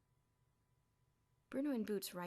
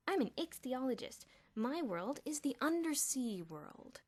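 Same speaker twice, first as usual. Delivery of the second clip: put-on nerdy boy voice; 6 semitones higher, 5 dB louder, slower than usual. A narrator's reading. Nerdy Elmer voiced high.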